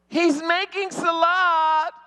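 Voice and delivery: high, slow, drawn-out announcement; imitated sister. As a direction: report it up to mother, stretched out. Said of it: "She would be like".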